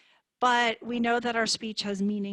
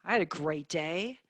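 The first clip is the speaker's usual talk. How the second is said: lower, dejected, contradicting the words; downcast inflection; 3 semitones lower than usual. Shown as a demonstration. Therapist shows same words, different meaning, downcast. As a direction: sigh it out as if the day went badly. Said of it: "completely different meaning".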